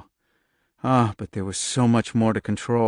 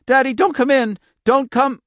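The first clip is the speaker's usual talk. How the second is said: shrill fear; 7 dB louder than usual. Acted. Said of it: "the shrill fear in her voice".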